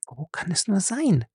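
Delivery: high, hushed worried question. Acted. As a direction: ask it anxiously, half aloud. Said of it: "fragte sie besorgt".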